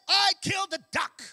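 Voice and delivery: shouted high, bursting out; boy confessing to grandma at full shout; desperate confession. Acted. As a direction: blurt it out, can't hold it in. Boy confessing after days of chores.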